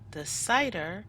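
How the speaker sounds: plain reading voice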